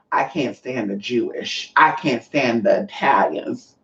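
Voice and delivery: imitated New York accent, low and slow; slow, low-pitched imitation of a New Yorker. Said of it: "You didn't get that New York accent".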